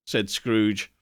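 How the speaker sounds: plain reading voice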